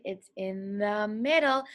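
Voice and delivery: ordinary talk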